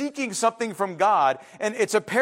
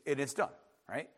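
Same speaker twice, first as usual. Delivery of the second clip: ordinary talk, nothing marked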